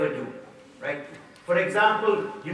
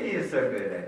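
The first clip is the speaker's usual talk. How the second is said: usual speaking voice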